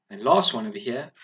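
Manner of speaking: usual speaking voice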